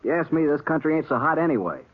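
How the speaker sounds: bitter outburst. Acted. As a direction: voice shooting up high, defensive and bitter. Pete lashes back at Britt.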